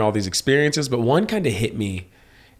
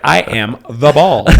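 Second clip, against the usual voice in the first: slow, loud declaration; acted grand pronouncement; mock-solemn comeback; 2 semitones higher, 6 dB louder, slower than usual. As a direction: announce it slowly and firmly, word by word. Acted deadpan comeback to rude question.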